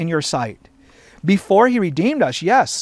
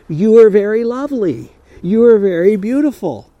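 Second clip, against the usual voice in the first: louder, ringing praise; exclaimed praise; 3 semitones higher, 7 dB louder than usual. Retold or imitated. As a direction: proclaim it to the whole room. Quoting God's praise of bride.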